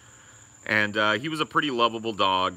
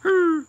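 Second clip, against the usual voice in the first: high, loud mock whine; imitated dog whine; 7 dB louder than usual. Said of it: "He's like".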